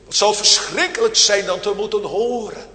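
usual speaking voice

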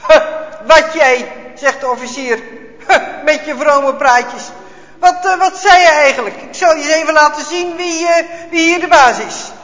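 loud, sneering and threatening; mock his pious talk, then threaten; scornful officer. A narrator's reading. Officer mocking grandpa's pious words, loud and sneering.

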